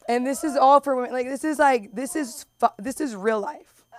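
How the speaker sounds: usual speaking voice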